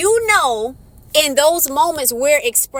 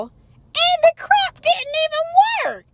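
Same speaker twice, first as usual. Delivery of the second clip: rising much higher in exasperation; exasperated. Real, not acted. Vlogger complaining the mic failed, squeaking higher. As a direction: whine it out, fed up. Frustrated about broken microphone.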